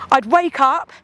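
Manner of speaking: ordinary delivery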